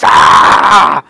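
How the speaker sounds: loud wordless yell of rage; real angry yell at the mouse; frustrated shout. Real, not acted. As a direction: let out a burst of anger.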